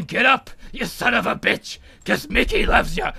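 put-on old trainer's urging, held back; Mick. Mick promises Rocky his voice will urge him, contained impression. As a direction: put on the mentor's voice without shouting. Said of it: "I want you to hear my voice".

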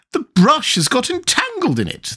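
high, woeful queen's voice; sighing. A narrator's reading. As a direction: say it as a high, resigned sigh. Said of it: "the Queen said with a sigh".

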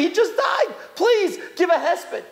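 high, imploring retold plea; pleading. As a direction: act out a mourner begging the rabbi. Mourners beg rabbi for eulogy.

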